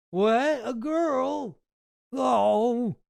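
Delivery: surprised. Retold or imitated. Acting Bannon's surprise.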